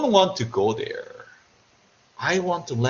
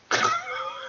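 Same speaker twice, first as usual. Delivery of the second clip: a much higher, teasing villain laugh; mock evil laugh; 6 dB quieter than usual. Lecturer joking about torturing his students, mock evil laugh.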